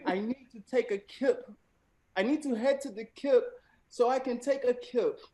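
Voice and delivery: rereading it in a lightly put-on British accent. Shown as a demonstration. Host repeating the example sentence in a hammed-up British accent. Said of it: "I will be attempting to read the sentence in a British accent".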